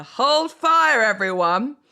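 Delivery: a sudden loud call to hold on. Real, not acted. Calling the audience to wait.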